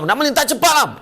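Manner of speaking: high, sharp shout at the pedestrian; impatient snap. Acted. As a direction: hurry them across, fed up. Memarahi orang yang melintas. (tengking orang melintas jalan)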